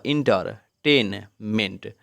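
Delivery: Entertainment very slowly as inter, टेन, meant; slow teaching split of entertainment into three; three syllables. Shown as a demonstration. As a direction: cut entertainment into three beats with pauses. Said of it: "तीन बार में बोला जाता है".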